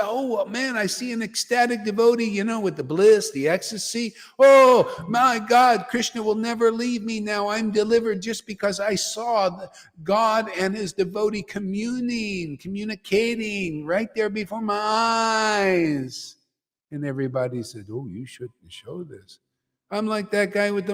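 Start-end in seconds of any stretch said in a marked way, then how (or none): 0.0-16.4 raised-pitch awestruck witness, stretching out at the end; acted rapture. Acts out awestruck witness of devotee. 17.9-19.4 low, soft mock caution; hushed disapproval. Everybody's timid objection, acted.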